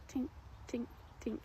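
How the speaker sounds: tinny tink imitation. Imitating the faint engine noise.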